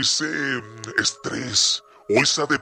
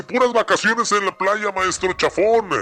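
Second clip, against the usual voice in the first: raised, louder jolly voice with a big ho ho; listener's message voiced; 6 semitones higher, 3 dB louder than usual. Host voicing a listener who hails Santa's arrival, jolly and high. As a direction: read it like a merry Santa fan.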